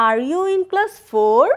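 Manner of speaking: "Are you in class four?" slowly, pitch rising at the end; slow model question climbing on "four"; rising intonation. Shown as a demonstration. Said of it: "rising intonation".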